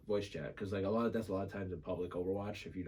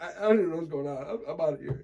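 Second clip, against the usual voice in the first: high, loud mock exasperation through laughter; laughing imitation; 8 semitones higher, 8 dB louder, much faster than usual. Retelling the confused teammate quitting, laughing mock exasperation. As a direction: imitate someone giving up while you laugh.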